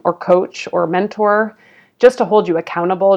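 ordinary talk, nothing marked

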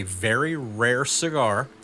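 very rare cigar slowed right down, very stretched; stress on very. Real, not acted. Telling his co-host about a rare cigar, stressing very. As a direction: lean hard on very, drawing out very rare cigar. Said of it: "I emphasize the word very".

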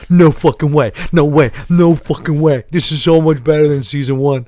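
put-on loud, high, over-the-top freakout as the kid; kid voice like John Travolta. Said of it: "I'm doing that voice for that kid".

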